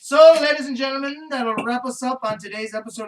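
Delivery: loud, high showman announcement; host sign-off. Acted. Host wrapping up the Comic Shop episode, a loud showman sign-off. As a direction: announce it to the crowd.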